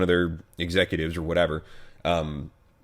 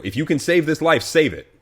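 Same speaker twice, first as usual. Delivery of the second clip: urgent plea; 9 semitones higher, 6 dB louder than usual. Retold or imitated. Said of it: "a baby life saying".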